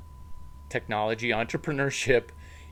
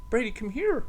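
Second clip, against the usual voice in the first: calling the dog; 11 semitones higher than usual. Real, not acted.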